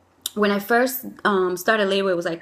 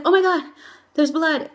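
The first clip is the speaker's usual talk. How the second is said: high, louder excited exclamation; retold excitement; 7 semitones higher, 3 dB louder than usual. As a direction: relive the excited discovery, pitched up. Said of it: "I really got excited".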